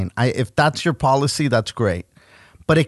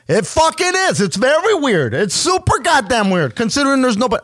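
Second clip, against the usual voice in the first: pitched way up in mock outrage; sarcastic high inner voice; 11 semitones higher than usual. To the clerk in his head, a high mocking retort. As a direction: squeak it out, sarcastic and fed up. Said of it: "I didn't say that".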